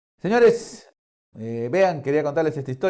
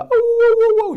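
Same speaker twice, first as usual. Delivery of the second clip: high, long drawn-out dog whimper howl; 9 dB louder, slower than usual. Acted. Storyteller howling as the crying dog. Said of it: "Sí, así lloraba".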